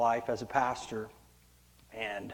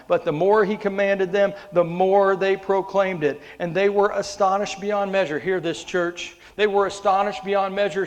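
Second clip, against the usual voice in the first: loud, ringing Bible reading from the pulpit; scripture proclaimed; 4 semitones higher, 8 dB louder than usual. Acted. Reads scripture to the church at full force.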